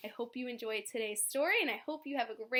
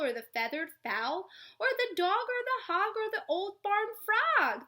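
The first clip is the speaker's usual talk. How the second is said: lively read-aloud storytelling; story narration; 7 semitones higher, 7 dB louder than usual. A narrator's reading. Teacher reading a story to children, lively. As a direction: read it like a bedtime picture book.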